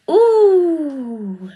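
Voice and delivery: loud high yawn with a sigh; big yawn. Real, not acted. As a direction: yawn wide, then sigh it out. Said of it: "That yawn just shifted the energy".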